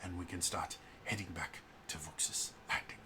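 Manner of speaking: soft, measured officer addressing the group; brief them quietly and formally; Declan. Acted. Game master voices Declan.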